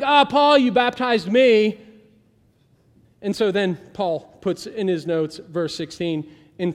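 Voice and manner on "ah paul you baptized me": loud, drawn-out call from the couch; mock lazy call-out. Stephanas calls to Paul from the couch, loud. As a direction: shout it over the football game. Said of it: "He's like".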